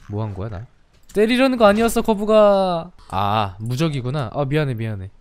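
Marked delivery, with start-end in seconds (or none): 1.1-2.9 panicked apology. Real, not acted. Apologising to turtle again.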